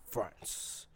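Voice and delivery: soft, half-hearted French accent attempt; quietly retry a badly faked French accent; hushed mock-French accent. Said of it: "French accent I could ever come".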